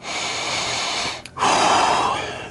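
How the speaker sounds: long, breathy exhale; heavy out-of-breath sigh; winded sigh. Real, not acted. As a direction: let out a long sigh to catch your breath. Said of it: "I'm out of breath".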